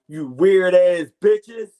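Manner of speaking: slow, loud and cutting; loud callout. Real, not acted. Podcast host slowly shouts an insult at his doubters. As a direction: spit each word out slowly and loudly.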